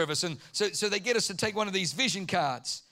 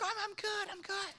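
much higher, much softer, sheepish brush-off; meek deflection; 4 semitones higher, 5 dB quieter than usual. Retold or imitated. Pastor sheepishly declining before God, higher and softer. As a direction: shrink away from the question. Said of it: "I'm like".

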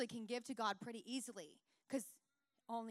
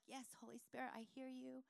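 soft, devout, serene reply; reading the Bible, answering the Holy Spirit in a soft voice; pious calm; 5 dB quieter than usual. Retold or imitated. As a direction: hush into mock holiness. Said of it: "and I'm like".

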